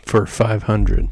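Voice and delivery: slow, loud quiz show host; announcer voice. Pretend. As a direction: draw it out like a game show host. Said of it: "I'll get my announcer voice".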